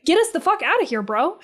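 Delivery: impatient rant at the masked killer; exasperated rant. Retold or imitated. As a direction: blurt it like you just want out. Venting about the horror characters.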